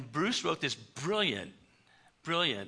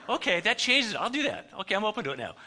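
sudden eager burst, high and loud; eager excitement; 8 semitones higher, 7 dB louder than usual. Retold or imitated. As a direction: act it out as an eager reversal. Bruce suddenly eager to accept.

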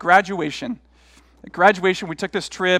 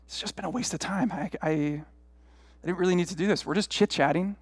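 very soft, disgruntled thinking aloud; hushed, weary complaint to yourself; muttered inner grumble; 8 dB quieter than usual. Retold or imitated. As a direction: grumble privately under your breath. Retold grumbling inner thoughts on golf.

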